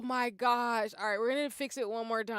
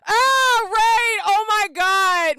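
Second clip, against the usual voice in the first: startled shriek; 9 semitones higher, 12 dB louder, much slower than usual. Real, not acted. Surprised by incoming raid.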